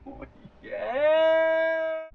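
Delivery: high triumphant "oh yeah"; excited shout. Real, not acted. Arrived in Fiji, shouting.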